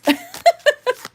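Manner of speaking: high giggling burst of laughter; a sudden high giggle. Real, not acted. Laughs about going nuts.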